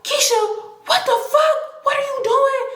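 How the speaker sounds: high, laughing disbelief; boyfriend's panic. Retold or imitated. Panicked boyfriend caught between two women, high and laughing.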